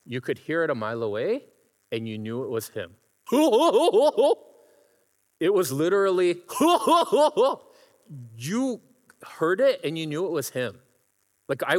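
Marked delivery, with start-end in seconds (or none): 3.3-4.3 high, loud cackling laugh imitation; imitated crazy laugh. The friend had this crazy laugh. 6.5-7.5 high, loud cackling laugh imitation; imitated crazy laugh. The friend had this crazy laugh.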